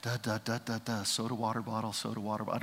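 soft, low droning gibberish; quiet mock murmuring; murmur drone. Shown as a demonstration. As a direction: drone like background chatter. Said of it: "mimicking murmur".

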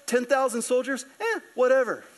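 acted unbothered dismissal; hanging out. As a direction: shrug it off as no big deal. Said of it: "he's just hanging out".

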